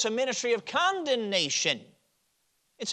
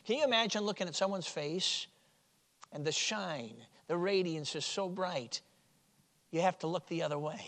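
hushed wonder; 3 semitones lower, 9 dB quieter than usual. Real, not acted. Wondering aloud, much softer.

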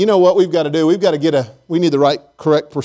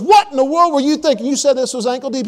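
higher, half-hearted indignant shout; mock angry yell; 9 semitones higher than usual. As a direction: play the outraged friend stuck in manure. Bobby yells from the manure.

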